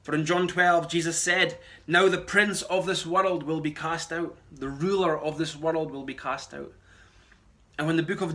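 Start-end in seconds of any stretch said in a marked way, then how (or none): none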